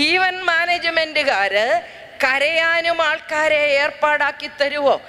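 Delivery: slow, deliberate repeat of the question; slow punchline repeat to the audience. Shown as a demonstration. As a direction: spell it out slowly for the crowd. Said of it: "repeat to audience".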